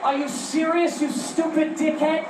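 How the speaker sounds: high, slow incredulous squeal; wife can't believe the line; wife mocking his cheesy proposal, high and outraged. Wife's incredulous reply.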